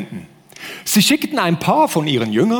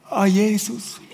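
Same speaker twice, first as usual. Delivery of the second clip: softer, slow, sighing devotion; mock worship sigh; 2 semitones higher, 4 dB quieter, slower than usual. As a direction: imitate a swooning worshipper, half breathed. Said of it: "Dann denkst du". (gespielt andächtiges Seufzen, leiser)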